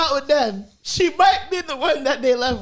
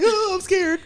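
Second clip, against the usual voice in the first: mock timid; 7 semitones higher than usual. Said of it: "she was like".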